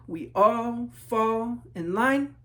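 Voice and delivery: slow, even, marching chant; slow refrain. A narrator's reading. Refrain of the marching class, slow chant. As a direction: drag it out in step like a marching line.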